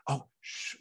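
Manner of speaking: put-on whispered shh to hush a baby; retold whispered shush. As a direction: hush her in a whisper, as in church.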